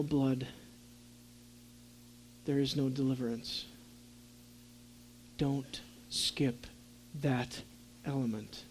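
low, very soft, grave teaching; hushed solemn preaching. Real, not acted. Preacher pressing the congregation not to skip the blood, hushed and solemn. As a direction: bring it right down to near-hushed gravity.

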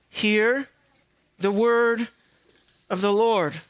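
long solemn pauses between phrases; emphatic, measured proclamation. Shown as a demonstration. Preacher stressing the command to the congregation, emphatic and spaced out. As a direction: set each phrase apart, weighty and deliberate. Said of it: "say it even more emphatic".

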